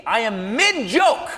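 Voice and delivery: ordinary talk, nothing marked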